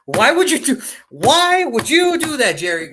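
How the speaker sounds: high, loud disbelieving yell; exasperated shout. Real, not acted. Fan yelling at Jerry Jones over the trade, exasperated shout.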